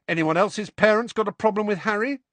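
high, challenging question; aggressive. A narrator's reading. Ron challenges the other boys about Harry, aggressive. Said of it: "he said aggressively".

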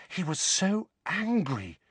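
high, slow, hushed awe; awestruck. A narrator's reading. Hermione telling Harry of Dumbledore's anger, awestruck. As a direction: draw it out, high and awed. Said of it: "in an almost awestruck voice".

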